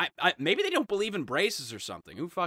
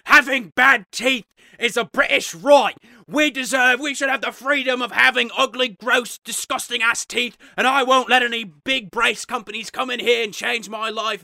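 loud, high, indignant British speechifying; hammed-up British MP defending his rights; old British politician; 6 semitones higher, 6 dB louder than usual. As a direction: declaim it like a pompous British politician. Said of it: "politicians in Britain are just so old".

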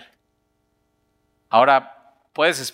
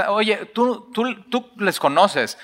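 higher, probing voice of an accuser; suspicious accuser; 7 semitones higher, slower than usual. Acted. Bystander questioning Peter, higher pointed voice. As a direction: press him, half-teasing, to admit it.

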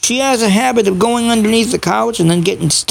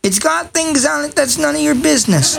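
higher, mock-stern telling off a pet; scolding the dog; 5 semitones higher than usual. Real, not acted. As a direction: shoo the dog away, half serious.

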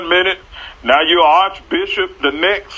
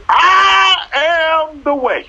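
preaching Jesus voice; 4 semitones higher than usual. Retold or imitated. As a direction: proclaim it like a preacher at full force. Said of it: "Jesus said".

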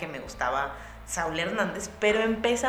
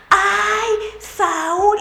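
high, loud fanboy squeal; dad gushing at his idol in delight; starstruck dad; 14 semitones higher, 10 dB louder than usual. Retold or imitated. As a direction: squeal his name like an overjoyed fan. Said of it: "el más emocionado del universo".

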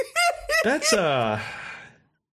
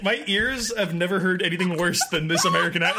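aghast through laughter; incredulous, laughing rant; laughing disbelief. Real, not acted. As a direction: protest while cracking up. Laughing at the terrible impression.

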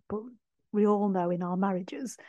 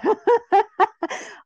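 high, loud burst of laughter; real laugh; 13 semitones higher, 10 dB louder than usual. Real, not acted. Laughing at own reaction.

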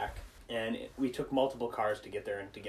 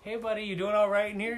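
high, quick acted dad voice; checking-in dad; 9 semitones higher, faster than usual. Dad checking on his boy in the stall, acted dad voice. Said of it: "his dad comes in and he's like".

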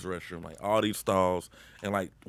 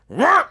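loud, high blaring fart noise; imitated loud fart; 13 dB louder than usual. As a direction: blast it out like a huge fart. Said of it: "It was like".

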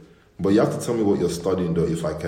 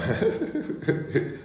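high imitated laughing-crying; 9 semitones higher than usual. Imitating Binta's laugh while crying. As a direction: mimic her odd laugh-cry. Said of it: "There's a laugh she does".

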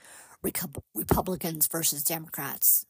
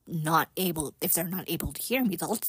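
ordinary delivery

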